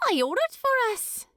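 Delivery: put-on a prim young hobbit chiding lightly; high crisp voice of a proper hobbit lass; Primula. Primula speaks.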